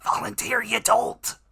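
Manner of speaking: angry shout. Acted. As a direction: bark it at the idiot. Cultist barking at underling.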